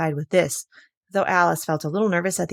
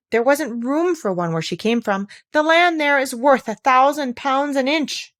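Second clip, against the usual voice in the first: chorus voice; 6 semitones higher, 4 dB louder than usual. Acted.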